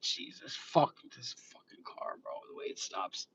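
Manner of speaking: whispered, exasperated swearing; cursing under breath. Real, not acted. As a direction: curse almost soundlessly to yourself.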